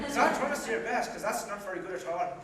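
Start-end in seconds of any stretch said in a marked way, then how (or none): 0.1-1.1 mocking laugh. [name] laughing at the bad joke, mocking laugh.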